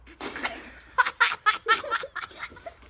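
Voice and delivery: bursting out laughing; a big real laugh; loud high laughter. Real, not acted. Laughing at the joke.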